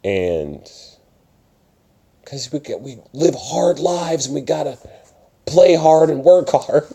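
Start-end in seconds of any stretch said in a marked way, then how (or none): 2.2-6.9 impassioned. Pretend. Emphatic impassioned claim.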